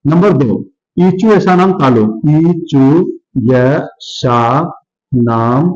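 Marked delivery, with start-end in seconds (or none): 2.3-5.7 word by word with long pauses; slow dictation. Shown as a demonstration. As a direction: spell out the sutra slowly, dropping lower. Teacher repeats the sutra for dictation. (धीरे-धीरे, रुक-रुक कर)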